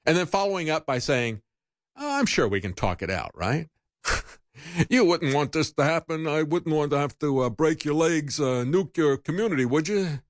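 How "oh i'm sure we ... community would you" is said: sarcastic mobster voice with a fake-friendly menace; a light gangster impression; mock mafia threat. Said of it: "this mafia-like reminder".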